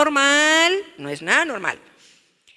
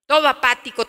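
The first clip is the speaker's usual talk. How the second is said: ordinary talk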